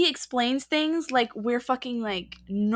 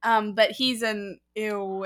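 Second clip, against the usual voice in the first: normal talking voice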